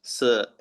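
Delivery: स drawn out slowly as a long hiss; स held long for eszett. Shown as a demonstration. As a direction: stretch स so the class hears its length. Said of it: "थोड़ा लंबा स देगा".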